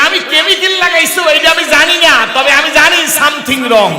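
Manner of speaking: loud, high shouted reply; shouted triumph; triumphant retort. Retold or imitated. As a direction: crow over the priest.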